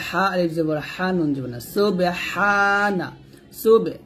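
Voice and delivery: usual speaking voice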